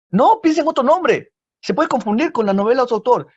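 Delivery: high, loud no, pick another name; loud rejection. Acted. Friends tell the author to change the title, loud and high. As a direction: shout it down like an outraged friend.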